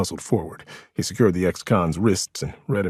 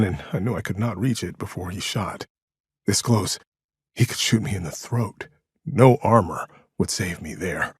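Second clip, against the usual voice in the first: plain reading voice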